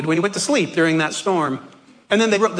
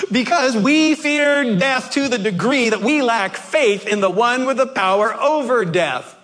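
usual speaking voice

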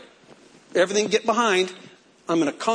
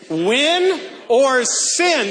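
slow, loud chant for the congregation; call and response; 6 semitones higher, 4 dB louder, much slower than usual. Shown as a demonstration. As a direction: lead the crowd in the slogan. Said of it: "Say it with me".

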